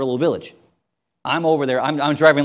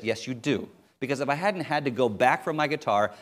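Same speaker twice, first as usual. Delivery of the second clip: ordinary talk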